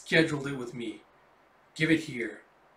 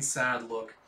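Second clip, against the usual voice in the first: ordinary delivery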